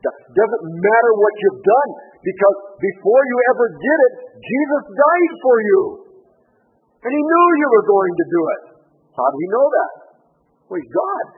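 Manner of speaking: high, loud, ringing preacher's cry; full-force preaching. Acted. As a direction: proclaim it over the whole room. Preaching climax on forgiveness.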